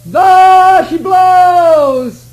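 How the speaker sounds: long high shout from the mast; cried out. Acted. Sam shouting down to the captain, long and high. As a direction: cry it out across the sea. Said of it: "he cried".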